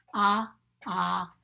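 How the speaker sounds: loud ah, ah as octopus begins; short O sound. Shown as a demonstration. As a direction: sound out ah twice, firmly. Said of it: "short sound for the letter O goes like this".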